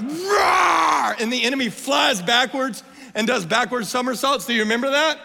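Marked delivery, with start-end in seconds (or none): 0.0-1.1 high, loud, full-throated roar; big lion roar. Acted. Mufasa scaring off the hyenas, mighty roar. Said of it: "there's this other roar that's like".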